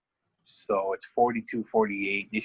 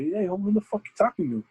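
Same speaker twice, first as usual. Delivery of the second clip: higher, pushy retold threat; imitating an offended coach; mock angry challenge; 10 semitones higher than usual. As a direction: square up, half-acted. Coaches square up in quarrel.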